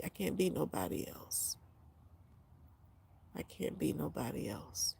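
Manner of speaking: whispered, breaking down to near silence; real emotional whisper. Real, not acted. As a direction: whisper it to yourself, spent. Quiet emotional self-admission.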